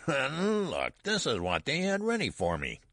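put-on very high old-man voice; high-pitched malicious old man; cackling old man. A narrator's reading. Cackling old man mocks the garment.

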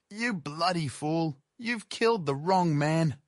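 high, agitated hiss, drawn out at first; hoarse furious whisper. A narrator's reading. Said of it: "blurted out in a hoarse, agitated and furious whisper".

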